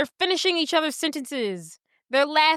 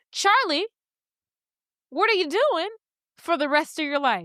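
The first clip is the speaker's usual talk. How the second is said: proposal; 4 semitones higher than usual. Retold or imitated. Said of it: "And he's like".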